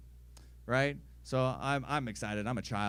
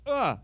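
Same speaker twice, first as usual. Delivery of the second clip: loud, high "ugh" of disgust; mock disgusted grunt; 9 semitones higher, 8 dB louder than usual. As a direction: recoil like an offended cat. Cat's disgusted reaction to thrown sock.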